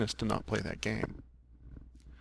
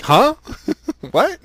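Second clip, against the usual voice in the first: loud, high "huh, what" in disbelief; louder and higher disbelieving squawk; baffled surprise; 12 dB louder than usual. Retold or imitated. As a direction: throw out a stunned double-take, laughing. Man baffled at being sent back.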